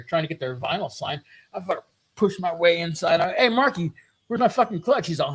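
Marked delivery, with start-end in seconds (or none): 3.3-5.1 raised-pitch retold confrontation; higher, testy acted question; annoyed demand. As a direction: call him out, irritated but not yet yelling.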